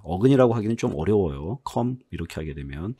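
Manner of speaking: ordinary talk, nothing marked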